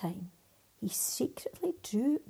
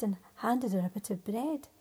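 usual speaking voice

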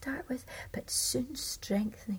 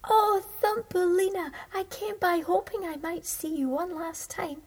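Swallow's voice; 7 semitones higher, 5 dB louder than usual. Acted. Swallow greets Thumbelina excitedly.

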